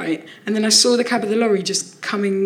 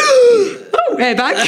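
much higher, delighted squeal over the airbag; thrilled gasp; 13 semitones higher, 4 dB louder, much slower than usual. Retold or imitated. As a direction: gasp like a kid who got a present. Waking up after crash, thrilled.

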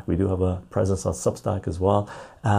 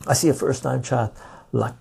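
ordinary talk, nothing marked

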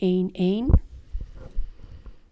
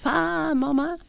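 put-on excited children calling; 8 semitones higher, slower than usual. Die komen op me af.

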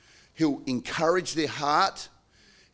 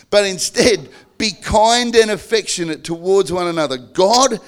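louder, raised reading of the verse; fervent scripture proclamation; emphatic scripture reading; 3 semitones higher, 7 dB louder than usual. Real, not acted.

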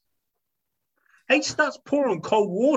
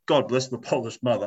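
ordinary talk, nothing marked

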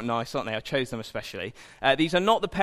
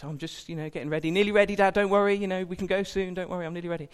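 put-on higher, eager little boy hurrying to reassure; child reassuring dad; 5 semitones higher, faster than usual. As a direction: play the seven-year-old insisting he's nearly ready. Child answering dad in story.

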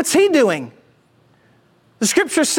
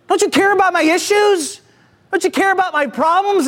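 raised, urgent mock plea of alarmed disciples; mild panic; 6 semitones higher than usual. Disciples wake sleeping Jesus in the storm, mildly panicked. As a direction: plead with some desperation, pitch up.

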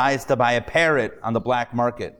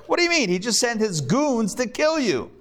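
astonished; 9 semitones higher than usual. Retold or imitated. Owner astonished at parrot.

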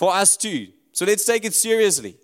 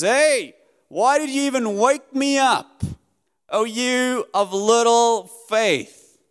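exasperated rebuke; 5 semitones higher than usual. Acted. Jesus rebukes panicking disciples.

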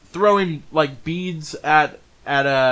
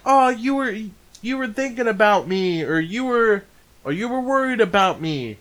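higher-pitched cutesy character voice; teasing, touched woman; 6 semitones higher than usual. Retold or imitated. Woman with a cat to worried boy, teasing. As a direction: imitate her cooing at the boy.

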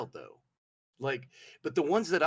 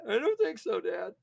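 put-on high, raised kid voice to Dad; reluctant son; 10 semitones higher, 5 dB louder than usual. Son refusing his dad's hammer cure, reluctant and high. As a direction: play a son pleading out of it, pitched up high.

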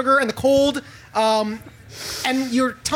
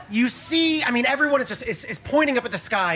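ordinary delivery

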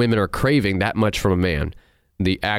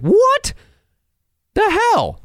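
shocked disbelief; much slower than usual. Real, not acted. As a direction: squeal what the hell, then laugh. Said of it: "shocked disbelief, then laughing".

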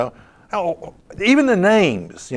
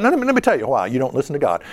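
ordinary talk

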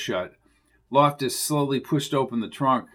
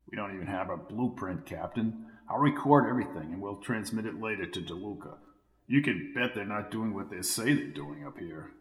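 softer, lower hushed planning; 4 semitones lower, 9 dB quieter than usual. A narrator's reading. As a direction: speak low while hiding. Hiding in trunk, planning.

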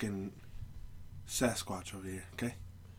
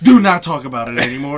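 loud, higher-pitched command; shouted cut-off; 5 semitones higher, 18 dB louder than usual. Real, not acted. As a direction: shut the topic down at full volume. Cuts brother off to end topic.

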